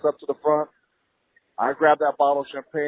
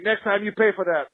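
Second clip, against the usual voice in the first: stern waiter; 6 semitones higher than usual. Retold or imitated. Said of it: "He's like".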